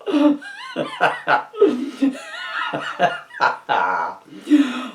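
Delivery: high giggling fit, can't stop; a high squealing laugh breaking through; helpless high laugh. Real, not acted. Reading a silly story to viewers, laughing helplessly.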